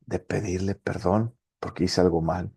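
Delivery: ordinary talk, nothing marked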